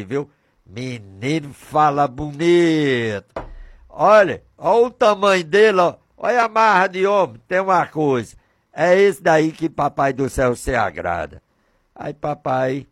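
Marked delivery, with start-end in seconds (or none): none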